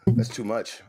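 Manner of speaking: usual speaking voice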